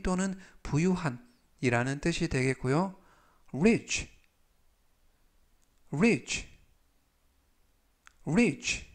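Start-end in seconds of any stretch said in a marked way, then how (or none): 3.5-8.9 rich said clearly, three times; 또박또박 rich 발음 시범; demo pronunciation. Shown as a demonstration. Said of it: "라고 발음하시면 되겠습니다". (또렷하게 rich 시범)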